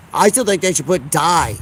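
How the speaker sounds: ordinary talk, nothing marked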